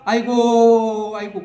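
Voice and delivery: long, loud mock wail that fades out; imitating a funeral lament; mourner's wail. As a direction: cry out like a chief mourner. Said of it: "곡을 하면".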